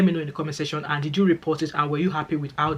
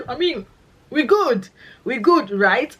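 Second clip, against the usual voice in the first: playful high check-in; 8 semitones higher, 5 dB louder, slower than usual. Acted. Playful reassurance to colleagues.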